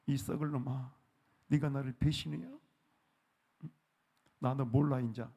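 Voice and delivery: ordinary talk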